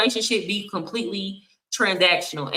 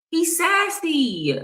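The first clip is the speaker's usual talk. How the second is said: much higher, slow, drawn-out sassy; slow, much higher sarcastic sing-song on sassy; sassy mock; 8 semitones higher, much slower than usual. Shown as a demonstration. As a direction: camp it up with a long, high sneer on sassy. Mocking a man's look.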